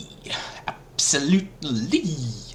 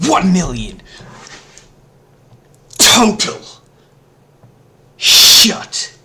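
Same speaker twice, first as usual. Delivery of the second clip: loud, higher shouted greeting; 4 semitones higher, 11 dB louder than usual. Pretend. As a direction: bellow it out to the room. Loud greeting before whispered repeat.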